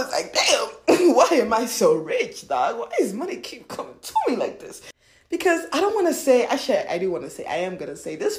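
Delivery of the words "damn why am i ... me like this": playfully incredulous, opening loud; mock amazement. Marvelling why money keeps coming, mock amazed. Acted amazement at being so rich.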